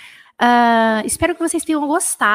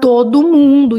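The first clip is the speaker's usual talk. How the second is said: loud call; 2 semitones higher, 9 dB louder, slower than usual. Real, not acted. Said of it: "calling to the audience".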